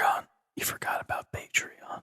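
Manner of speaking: hushed, voiceless prompt; soft whispered aside. Pretend. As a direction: whisper a sly reminder. Creepy prompt to co-host.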